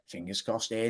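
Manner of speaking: whispered, fingers crossed; hopeful whisper. Real, not acted. Streamer whispering his hope for a big signing. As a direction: breathe it out hopefully.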